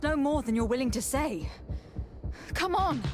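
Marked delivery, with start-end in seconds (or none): 2.5-3.0 sharp, high, loud push; impatient shout. Acted. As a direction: snap at him to talk. Interrogator pressing a stubborn prisoner.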